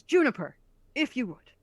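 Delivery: politely cue the goblin; a touch of the priest. Acted.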